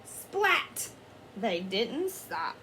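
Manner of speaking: ordinary delivery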